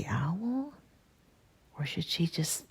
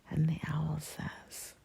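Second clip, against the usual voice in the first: plain reading voice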